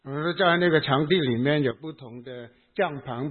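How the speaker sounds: ordinary talk, nothing marked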